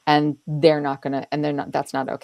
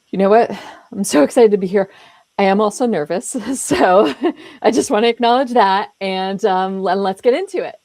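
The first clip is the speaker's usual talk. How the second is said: louder, upbeat and confident, with a light laugh; frank acknowledging; 3 semitones higher, 7 dB louder than usual. Acted. Speaker greeting the audience and admitting nerves, frank acknowledging. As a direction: own the nerves openly and move on. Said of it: "difference between apologizing for it and acknowledging".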